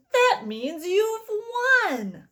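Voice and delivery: high, slow cry of delight breaking out; excited cry. A narrator's reading. Wonka tells Charlie he has won, crying out in excitement. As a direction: let the joy burst out on you've. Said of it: "he cried out".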